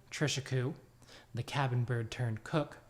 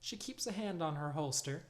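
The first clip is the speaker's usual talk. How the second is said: usual speaking voice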